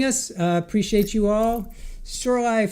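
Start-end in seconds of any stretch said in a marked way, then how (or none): none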